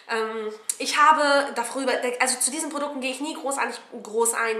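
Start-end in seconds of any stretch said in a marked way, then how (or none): none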